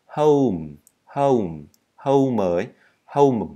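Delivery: "home" as "how-m", gliding vowel closing on m; drawn-out oh-oo in "home", then m; diphthong plus M. Shown as a demonstration. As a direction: drill "home" in separate falling repeats. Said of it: "âm âu cộng thêm chữ M thôi".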